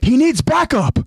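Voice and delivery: high-pitched alarmed shout; urgent call. Acted. Jean telling Armin their friend needs help, urgent call.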